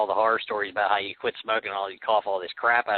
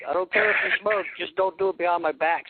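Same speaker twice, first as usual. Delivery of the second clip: higher-pitched mock mother's scolding; raised, high voice of a retold mom; imitated mom; 6 semitones higher than usual. Acting mom calling from downstairs.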